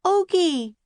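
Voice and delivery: high loud call. A narrator's reading. Calling again for the puppy in the woods. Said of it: "they called".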